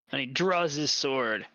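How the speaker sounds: ordinary talk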